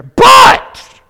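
one explosive, very high shout; emphatic shout. Real, not acted. Preacher turns from death to thanks be to God, shouting. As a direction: blast the word out to turn everything around. Preacher's turning word in verse.

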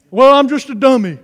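self-declared dummy. Acted. Preacher acting out someone who thinks himself stupid, loud mock dummy voice. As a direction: play the dummy, loudly running yourself down. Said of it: "don't talk like that".

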